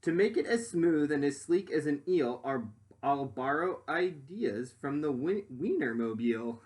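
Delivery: lively rhyming storybook read; reading to children. Acted. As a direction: read it out to the class with bounce. Reads picture book aloud.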